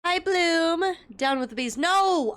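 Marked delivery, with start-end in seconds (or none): 0.0-1.0 mock greeting. Streamer mimics the game voice. 1.8-2.3 sharp no. Acted. Corrects her slogan on the bees.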